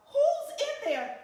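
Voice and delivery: loud, high demand into the tent; startled angry shout. Acted. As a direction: yell it out at whoever's hiding. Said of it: "the man shouted".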